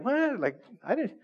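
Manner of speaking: sudden high shocked "what". Retold or imitated. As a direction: pitch jumps up in disbelief. Shocked at yearly DMV fees.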